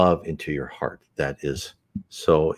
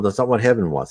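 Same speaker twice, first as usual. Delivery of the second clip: ordinary delivery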